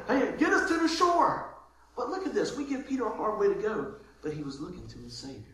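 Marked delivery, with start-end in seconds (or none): 0.1-1.6 high, slow panicked plea; mock panic. Frightened disciple begging to reach the shore, panicky. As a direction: beg to be taken back to land. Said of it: "I'd be like".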